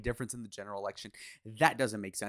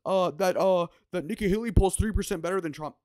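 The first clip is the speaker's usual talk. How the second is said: much higher, louder nasal-free mock nerd voice; mocking dumb know-it-all; 9 semitones higher, 6 dB louder than usual. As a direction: squeak it out like a proud midwit. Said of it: "One ten I Q be like".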